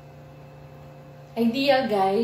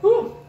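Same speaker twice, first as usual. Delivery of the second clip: loud spicy gasp; 11 semitones higher, 8 dB louder than usual. Real, not acted. Burning from spicy food.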